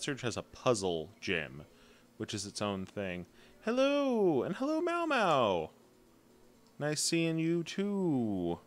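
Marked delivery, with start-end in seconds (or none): 3.6-8.6 very high, drawn-out hello; high-pitched welcome with stretched vowels; singsong greeting. Real, not acted. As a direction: greet them in a high, lilting coo. Greeting a viewer who arrives.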